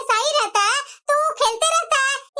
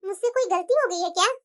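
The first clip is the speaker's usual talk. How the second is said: a hint of a meek, softer young wife; timid younger bahu; 8 semitones lower, 4 dB quieter than usual. Younger bahu asking her elder sister-in-law, lightly voiced and meek. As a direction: shrink back like the scolded newcomer.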